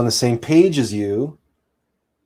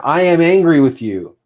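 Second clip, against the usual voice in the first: raised, higher, louder blaming line; angry accusation; 6 semitones higher, 5 dB louder than usual. Shown as a demonstration. Partner told off with an angry outburst. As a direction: snap it at your partner like an accusation. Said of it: "Never say to your partner".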